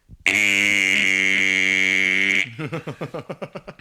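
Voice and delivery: loud, long rising electronic roar done with the voice; imitated speaker crash noise. Sound system crashing through the speakers, loud vocal imitation. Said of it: "You do that really well".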